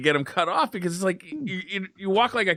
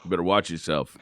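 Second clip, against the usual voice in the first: mock tough threat; 13 semitones lower, 4 dB quieter than usual. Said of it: "and said".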